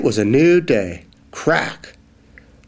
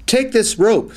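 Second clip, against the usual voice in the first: eager mouse call; 10 semitones higher than usual. Acted.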